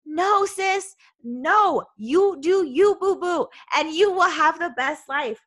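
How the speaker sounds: high, loud, emphatic cheerleading; sassy pep talk. Acted. Mock pep talk to listener as sis.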